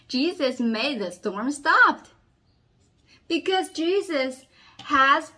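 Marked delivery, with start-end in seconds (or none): none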